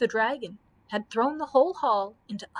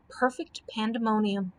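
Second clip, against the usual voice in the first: ordinary delivery, nothing marked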